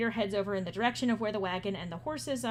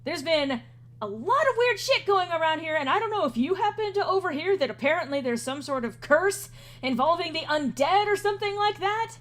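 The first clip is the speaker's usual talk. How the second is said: raised, louder, agitated complaint; glaring irritation; 6 semitones higher, 3 dB louder than usual. Acted. Edgar explains the curse to Jimothy, agitated and irritated. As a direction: rant with rising, irritated pitch. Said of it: "Edgar glares and then says".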